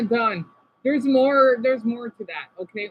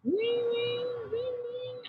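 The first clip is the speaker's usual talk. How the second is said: high, fading sing-song "wing, wing, wing, wing"; mimicking a Quebec hello call, high and fading; Quebecois greeting imitation; 12 semitones higher, 8 dB quieter, slower than usual. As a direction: chirp the greeting up high and let it die away. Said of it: "that's how they say hello".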